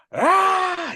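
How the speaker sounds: high, loud imitated scream; pissed-off scream. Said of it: "He screams and yells".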